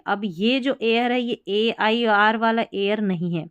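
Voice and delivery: usual speaking voice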